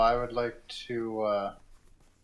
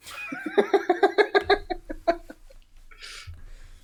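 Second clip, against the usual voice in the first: squealing laughter, much higher; 4 dB louder than usual. Real, not acted. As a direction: laugh up high and long. Laughing at the noise remark.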